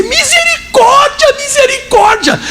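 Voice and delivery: very high, drawn-out mock wail; wailing plea. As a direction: imitate a crying woman begging for mercy. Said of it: "começou a chorar e dizer". (imitando súplica chorosa, aguda e arrastada)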